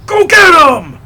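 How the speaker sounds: high, loud holler; shouted command. Acted. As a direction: holler it like sending someone charging. Calling out an attack order.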